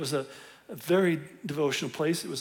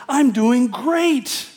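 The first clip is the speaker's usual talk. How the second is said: slow, higher, louder fake brightness; brushing off concern with forced cheer; fake cheerful; 8 semitones higher, 7 dB louder, much slower than usual. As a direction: pretend all is fine. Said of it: "would have said".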